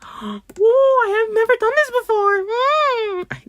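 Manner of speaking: suddenly very high, excited squeal; squeaky high voice. Real, not acted. Startled by a find, voice shoots up high. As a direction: voice jumps way up without meaning to. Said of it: "why am I talking like that?".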